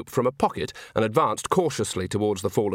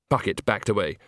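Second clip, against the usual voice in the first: usual speaking voice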